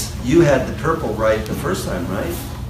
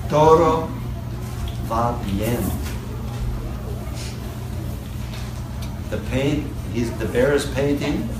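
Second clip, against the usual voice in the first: put-on reading to a toddler. Reads picture book to child.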